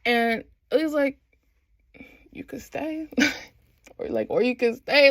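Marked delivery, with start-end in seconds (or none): 2.3-3.1 soft, hushed mock invitation; tentative offer. Ilya asking Shane to stay, tentative. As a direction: imitate a shy, quiet offer. Hesitant invitation acted out, then repeated.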